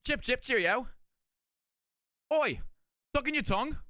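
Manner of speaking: mock British, chip chip cheerio style; hammy fake British voice; fake British accent. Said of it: "Gotta do our fake British accent".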